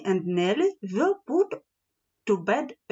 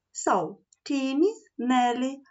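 usual speaking voice

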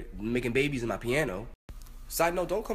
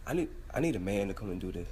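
normal talking voice

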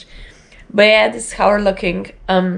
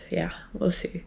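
whisper; 12 dB quieter than usual. Real, not acted. Repeated as trailing whisper.

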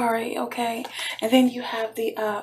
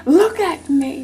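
louder plea for attention; insist, pushing the voice out; insistent claim; 3 semitones higher, 7 dB louder, slower than usual. Acted. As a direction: demand to be looked at. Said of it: "this person is claiming".

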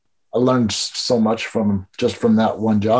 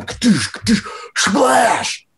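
pitched-up whoosh and splash sounds, much higher; mock splash noises; 10 semitones higher, slower than usual. Telling a prank story, high imitated splash noises. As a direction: spit out the noises of a body hitting water. Mimicking the tackle into mud.

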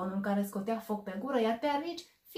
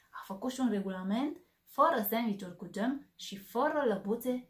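put-on lively, animated read-aloud for kids; expressive bedtime story narration; storybook reading; 2 semitones higher, 4 dB louder than usual. A narrator's reading. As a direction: bring the story alive for little listeners. Reads picture book to children.

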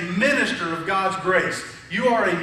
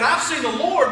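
higher-pitched, thrilled announcement; excited proclamation; 5 semitones higher, 3 dB louder than usual. Retold or imitated. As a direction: retell her news with excitement. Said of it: "tell the excitement in her voice".

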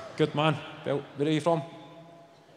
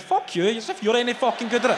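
high-pitched mock outrage; indignant retort; 7 semitones higher than usual. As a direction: fire back, pitch jumping up. Snaps back at friend's feedback.

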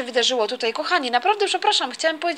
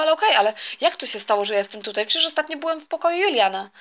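ordinary delivery

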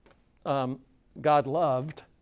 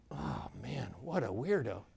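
muttered to yourself; 2 semitones lower, 8 dB quieter than usual. Retold or imitated. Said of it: "to yourself".

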